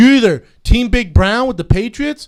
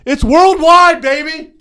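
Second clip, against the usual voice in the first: mock hype; 8 semitones higher, 6 dB louder, slower than usual.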